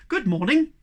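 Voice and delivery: called out brightly. Acted. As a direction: sing out a bright hello. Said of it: "called out brightly".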